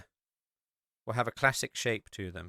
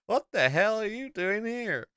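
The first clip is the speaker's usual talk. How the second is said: high, loud incredulous local; hammed-up bewildered country local; baffled American local; 10 semitones higher, 6 dB louder than usual. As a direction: demand it in comic disbelief, voice way up. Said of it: "they're like".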